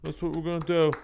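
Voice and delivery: mock a governor happily rubber-stamping a bad idea. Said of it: "they're just like".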